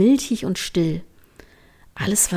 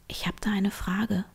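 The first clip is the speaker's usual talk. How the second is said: hushed; 8 dB quieter than usual. Acted. Nervous before a proposal.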